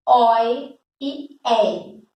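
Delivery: ordinary delivery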